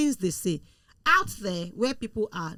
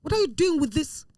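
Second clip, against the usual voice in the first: imitated shouting; 6 semitones higher than usual. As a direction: act out a mother indignantly berating her daughter. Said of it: "then she started shouting".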